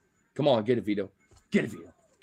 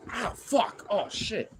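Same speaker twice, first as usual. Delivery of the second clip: in pain; 8 semitones higher, slower than usual. Real, not acted. Hurt during dogs' rough play.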